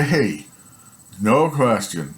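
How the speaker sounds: ordinary talk, nothing marked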